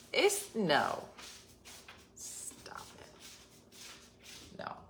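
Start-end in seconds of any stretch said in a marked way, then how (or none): 2.2-4.8 breathy aside, barely audible; whispered stop it. Real, not acted. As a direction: mutter it to yourself in disgust. Disgusted aside under breath.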